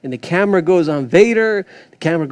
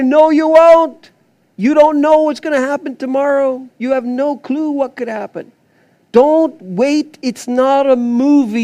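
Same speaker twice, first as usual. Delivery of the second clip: forceful preaching; 6 semitones higher than usual. Acted. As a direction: hammer the warning home to the fathers.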